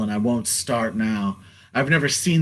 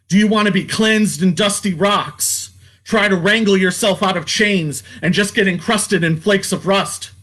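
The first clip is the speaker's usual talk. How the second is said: raised, higher preaching holler; fevered hollering at the crowd, higher and louder; 6 semitones higher, 3 dB louder than usual. Retold or imitated. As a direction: call out the questions like a street prophet, higher and louder. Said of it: "I ran away hollering".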